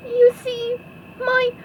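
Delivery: squeaky sponge, tired and sorry; SpongeBob voice. Acted.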